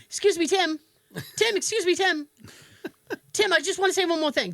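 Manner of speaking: a patient keeps calling nurse Tim, mock nagging. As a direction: pester the nurse over and over. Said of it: "continually said Tim's name".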